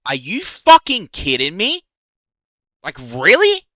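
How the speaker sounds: loud, high, disbelieving yell; outraged shout. Real, not acted. Reading the harasser's messages, outraged shout. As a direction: blast it in total disbelief.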